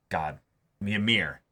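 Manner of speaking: usual speaking voice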